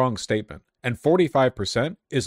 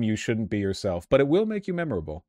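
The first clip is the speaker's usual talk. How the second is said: ordinary talk, nothing marked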